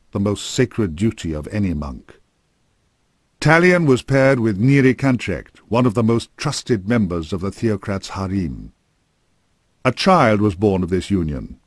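ordinary delivery

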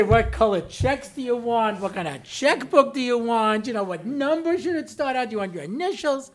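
imitating an overjoyed bank teller; eager to please. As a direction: fawn over the new customer. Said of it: "they're so happy to see you".

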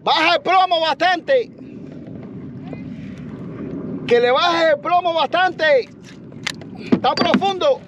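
high, slow, drawn-out shout; calling out. Real, not acted. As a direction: holler each word slowly to carry far. Calling out to drop weight.

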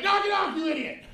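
high, sharp, laughing scold; scolding wife. Retold or imitated. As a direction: snap at him, fed up.

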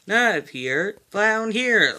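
ordinary delivery, nothing marked

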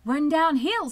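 loud, high call; urgent advice. A narrator's reading. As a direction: call out a direction across the yard. Animals shouting advice to Wilbur.

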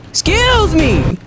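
very high, long drawn-out demand; exaggerated excuse me. Gamer to a character still in his way, exaggerated sass. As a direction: stretch it out way up high.

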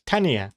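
Tanya said TAN-ee-a; three-syllable Tanya. Shown as a demonstration. Streamer checking the other pronunciation, Tanya in three syllables. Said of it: "Isn't that how you pronounce it?".

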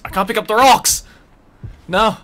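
loud, high outburst at the game; real angry gamer shout; frustrated yell. Real, not acted. Game won't let him pick up rock.

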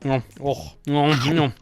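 spoken "nom nom" eating noises; mock munching. Hungry ghost eating the man's food, playful munching. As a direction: gobble imaginary food.

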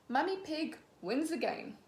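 ordinary delivery, nothing marked

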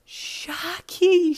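high, loud gasp of a name; the name bursts out in shock; stunned recognition. Acted. Mum recognising the grown boy, stunned and delighted. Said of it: "then her jaw fell open".

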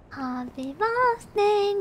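ordinary talk

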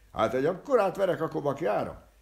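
high, loud furious cry; shouting. Acted. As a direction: yell it out in rage. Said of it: "kiáltott fel az ember". (magas, hangos, dühös kiáltás)

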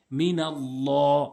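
মিনাল্লাহ drawn out slowly, the ল full and thick; heavy Allah. Shown as a demonstration. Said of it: "আল্লাহ শব্দটি ল উচ্চারণ হয়". (মিনাল্লাহ ধীরে, ভারী ল দিয়ে তিলাওয়াত)

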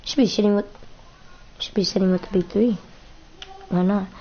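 normal talking voice

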